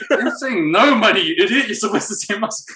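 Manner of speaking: laughing at dad's mistake while scolding him; laughing correction. Retold or imitated. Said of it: "And I'll be like".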